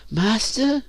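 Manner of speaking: high falsetto, small-voiced query; thin, high questioning voice; questioning slave girl. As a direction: ask timidly in a high girl's voice. Slave questions master in dismay.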